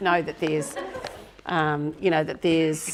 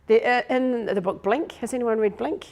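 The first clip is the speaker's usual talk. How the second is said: usual speaking voice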